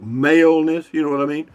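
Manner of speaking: normal talking voice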